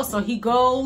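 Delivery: ordinary delivery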